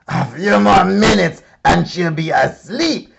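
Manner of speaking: loud, greedy monster gloat; gloating dinosaur. A narrator's reading. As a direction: savour every word like a hungry beast. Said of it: "a dinosaur gloating".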